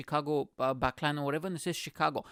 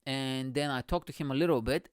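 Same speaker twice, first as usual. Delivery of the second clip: ordinary delivery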